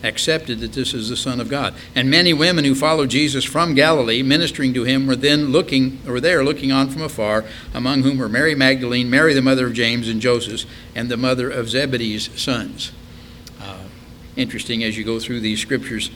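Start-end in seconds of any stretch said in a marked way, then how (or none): 1.9-12.9 louder, projected Bible verse; scripture reading. Acted. Preacher reading of the women looking on from afar, declaimed. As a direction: read the verse out to the congregation.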